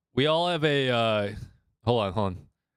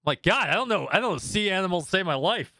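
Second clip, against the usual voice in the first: usual speaking voice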